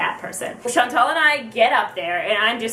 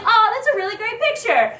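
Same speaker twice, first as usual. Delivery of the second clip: high-pitched encouraging praise; [name]; 10 semitones higher than usual. Retold or imitated. Idol being shown the picture, high-voiced and encouraging. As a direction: gush in a put-on high voice. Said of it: "and she's like".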